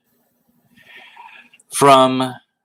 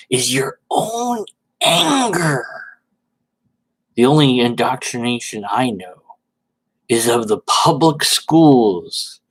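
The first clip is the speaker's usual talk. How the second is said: mock preachy voice. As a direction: play the self-righteous zealot. Mock-reading Red Dead's reply.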